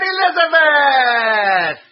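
high, loud, drawn out; loud call. A narrator's reading. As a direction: call out shrilly. Ronald calls out.

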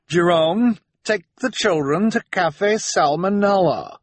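high, grand society hostess; imperious socialite Esme. Acted. Esme sends Jerome off with the children, imperious and high. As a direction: breezily order everyone about in a high voice.

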